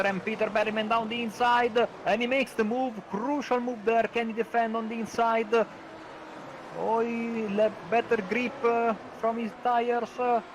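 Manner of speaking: high, rising live commentary; excited race call. Real, not acted. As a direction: call the pass with building thrill. Overtake called live.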